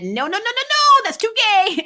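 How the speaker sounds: high, loud, hammed-up refusal; mock a panicked TV executive shutting it down; network exec voice. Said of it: "the network said".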